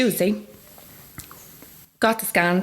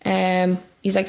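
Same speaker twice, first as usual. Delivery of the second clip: usual speaking voice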